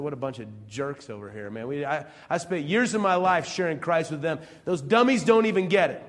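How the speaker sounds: rising to an exasperated near-shout; hammed-up indignant missionary; mock frustrated rant. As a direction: vent like a fed-up missionary. Preacher acts out frustrated missionary.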